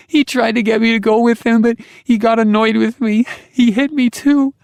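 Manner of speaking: put-on high, loud child voice; a frightened little girl blurting it out. A narrator's reading. Daughter tells her father, high scared child voice.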